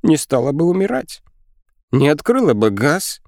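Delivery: high-pitched wailing rebuke; high, aggrieved lament; bitter reproach. A narrator's reading. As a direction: throw blame in a high, upset voice. Quarrel goes on, reproaches in high voice.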